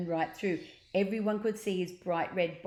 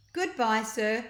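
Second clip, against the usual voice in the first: higher, chirpy mock politeness; sarcastic chirp; 4 semitones higher, 5 dB louder than usual. A narrator's reading. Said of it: "chirped Ned sarcastically".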